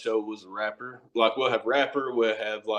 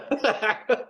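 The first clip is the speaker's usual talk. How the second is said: high giggling laugh; 11 semitones higher than usual. Real, not acted. Laughing along.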